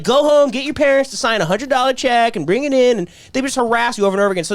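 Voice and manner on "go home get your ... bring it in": higher, louder pushy pitch; pushy fundraiser. Retold or imitated. Fundraisers push students for money, pushy. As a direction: press them like a pushy fundraiser. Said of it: "they'd be like".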